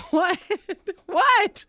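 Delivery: loud high "What?"; shocked yell; surprised shout. Real, not acted. Reacting to partner's shot.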